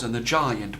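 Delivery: ordinary delivery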